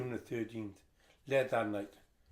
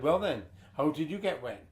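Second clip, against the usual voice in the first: raised, stern questioning; stern demand; 4 semitones higher, 6 dB louder than usual. Retold or imitated. Stern mum demands an answer.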